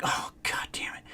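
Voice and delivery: whispered under the breath in defeat; muttered whisper. Retold or imitated. Telling a friend how he gave up on a coworker, whispered. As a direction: breathe it out unvoiced, as if to yourself. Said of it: "And I'm like".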